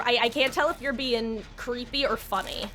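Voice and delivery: ordinary talk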